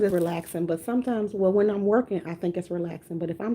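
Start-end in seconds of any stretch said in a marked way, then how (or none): none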